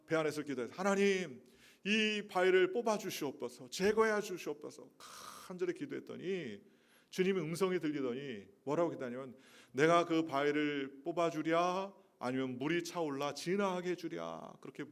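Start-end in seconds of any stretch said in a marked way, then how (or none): 0.7-4.9 desperate prayer. Retold or imitated. Man praying desperately in dream. 9.7-14.6 voice of God. Retold or imitated. Voice of the Lord asking.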